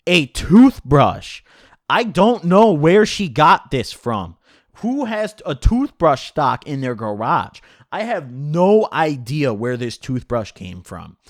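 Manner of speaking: can't believe it was a toothbrush; incredulous. Real, not acted. Disbelief at toothbrush attack.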